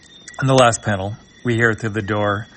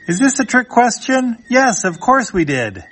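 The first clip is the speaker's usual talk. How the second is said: much higher put-on voice; high character voice; 13 semitones higher, 4 dB louder than usual. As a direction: squeak it out high for the parents. Parents voiced in comic reading.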